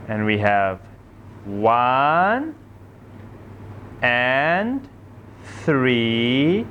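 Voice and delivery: patient sing-song counting; gentle teacher talking to small children; kindergarten teacher voice. Acted. Teacher guiding children through worksheet.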